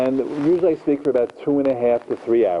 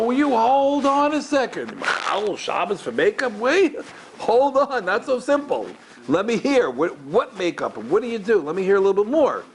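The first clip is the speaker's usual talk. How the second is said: high-pitched, hold-everything protest; incredulous, urgent questioning, acted out; startled disbelief; 9 semitones higher than usual. Rabbi questioning a crying student's confession, high incredulous interruption.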